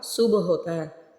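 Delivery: सुब with a crisp final b; सुब, b released clearly; pron of सुब. Shown as a demonstration. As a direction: say सुब with a bouncing b. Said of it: "सुब नहीं होता".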